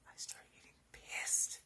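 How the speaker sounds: breathy whisper, almost under the breath. Shown as a demonstration. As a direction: repeat it as a private aside to yourself. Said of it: "And then I start getting pissed".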